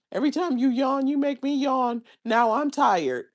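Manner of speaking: high-pitched mocking imitation of a complainer; mimicked complaining coworker.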